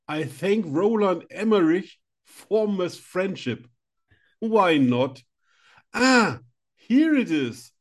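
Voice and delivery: gushing award winner, hammed up; mock Oscar speech. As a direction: play the grateful Oscar winner. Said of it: "das ist meine erste Oscar-Rede".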